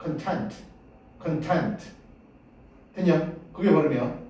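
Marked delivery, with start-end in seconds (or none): 0.0-1.8 content의 뒤 음절 tent에 강세; content, 앞은 약하게 tent를 세게. Shown as a demonstration. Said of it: "액센트가 뒤에 있지". (content를 뒤에 힘주어)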